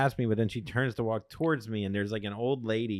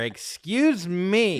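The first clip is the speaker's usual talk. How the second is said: high, loud, drawn-out Excuse me; snooty excuse me; 7 semitones higher, 5 dB louder, much slower than usual. Retold or imitated. Boyfriend mimicking girlfriend's church rebuke, high and slow. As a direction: stretch it out like a haughty rebuke. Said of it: "So Brenna goes".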